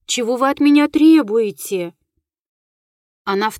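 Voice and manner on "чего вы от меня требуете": louder, agitated demand; exclaiming. A narrator's reading. Руневский asks what the ghost demands, exclaiming. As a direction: exclaim the question with more force. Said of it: "воскликнул Руневский".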